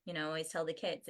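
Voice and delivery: ordinary talk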